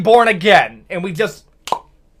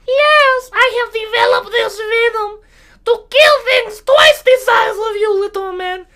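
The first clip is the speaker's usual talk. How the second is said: shrill bee threat; 14 semitones higher than usual. Acted. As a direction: threaten like a furious tiny bee.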